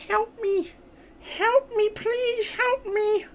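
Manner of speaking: tiny high soft squeak; pleading little Wiglet. Pretend. Threatened Wiglet begs for help, tiny pleading voice. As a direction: squeak out a helpless plea.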